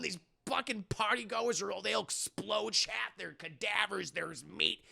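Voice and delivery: ordinary talk, nothing marked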